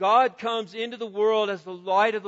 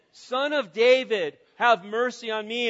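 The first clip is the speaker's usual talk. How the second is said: retold shout; 3 semitones higher, 5 dB louder than usual. Blind man shouts to Jesus over the crowd, calling out. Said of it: "he shouts out again".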